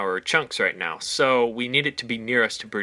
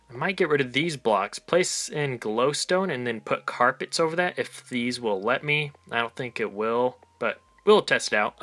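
normal talking voice

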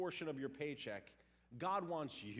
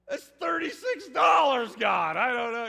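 much higher, loud panicked cry; young tither panicking at the new amount, yelling to God; 10 semitones higher, 19 dB louder than usual. Retold or imitated. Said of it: "it's like I started to panic".

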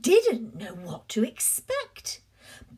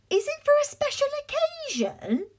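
shop assistant voice; 13 semitones higher, faster than usual. Acted. Shop assistant asks Cake.

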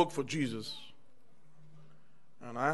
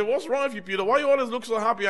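high, louder, quick mock coworker asking; coworker's question; 7 semitones higher, 6 dB louder, faster than usual. Coworker at work asks Peter why he's happy, higher quick voice. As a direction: needle him like a puzzled coworker.

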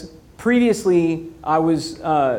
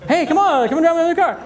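much higher and louder, bragging friend; mock eager invite; 10 semitones higher, 3 dB louder than usual. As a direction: call out to friends showing off. Inviting friends to his Tesla.

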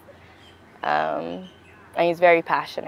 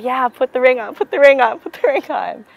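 mock eager, pitched up, hurrying him along; eager yes; 6 semitones higher than usual. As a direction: act out grabbing for the ring, excited. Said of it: "And I was like".

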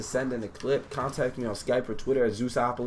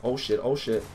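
usual speaking voice

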